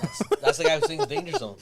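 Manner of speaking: laughing. Real, not acted. Laughing while naming the singer.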